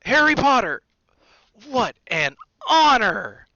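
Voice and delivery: loud, high, overjoyed exclamation; thrilled. A narrator's reading. Bartender meeting a celebrity, loudly thrilled. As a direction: cry it out, stretching the honour.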